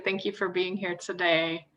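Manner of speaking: normal talking voice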